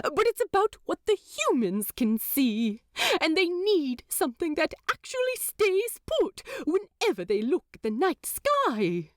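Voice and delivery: a prim angel, caught out and flustered; Aziraphale voice. A narrator's reading. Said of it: "Aziraphale flaps the scroll at Crawly again".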